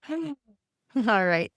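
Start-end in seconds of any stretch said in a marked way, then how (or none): 0.0-0.3 quiet sigh starting high; demonstrated out-breath sigh; soft sigh. Shown as a demonstration. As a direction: let a small soft sigh out. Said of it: "do a sigh".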